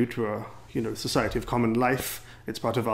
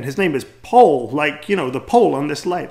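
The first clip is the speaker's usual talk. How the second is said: translating; 3 semitones higher, 8 dB louder than usual. Retold or imitated. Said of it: "translate again".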